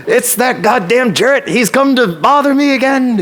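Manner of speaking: very high-pitched mock-grumpy holler; imitated yell. Friend greeting her regular visitor from the stoop, mock-annoyed yell. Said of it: "And she'd yell it at me".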